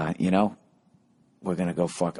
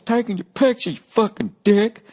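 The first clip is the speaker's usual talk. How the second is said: yelling heckler; 14 semitones higher, 4 dB louder than usual. Retold or imitated. Heckler yelling at the comic from the crowd, high and loud. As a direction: bellow it like an offended drunk in the crowd. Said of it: "I just heard this guy's voice".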